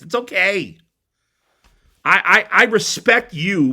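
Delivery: ordinary delivery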